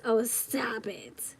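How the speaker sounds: a drawn-out hushed stop it; slow whisper. Real, not acted. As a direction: whisper it slowly, teasing. Reader reacting to the reading.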